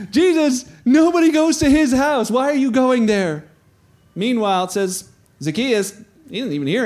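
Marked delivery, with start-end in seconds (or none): 0.1-3.4 high, loud, incredulous protest; mock outrage. Townspeople questioning Jesus at Zacchaeus's house, mock outrage. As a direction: play the scandalized onlooker. Crowd objecting to Jesus's visit.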